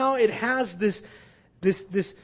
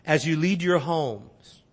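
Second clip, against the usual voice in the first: ordinary talk, nothing marked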